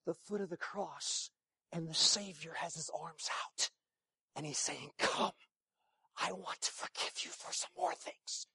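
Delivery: soft, breathy retold whisper; hushed whisper. Brother confessing on the phone, in a hushed whisper. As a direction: let it out quietly, almost unvoiced.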